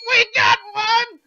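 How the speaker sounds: high, slow, excited shout; excited yell. Retold or imitated. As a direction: cry out like she caught one. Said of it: "she goes".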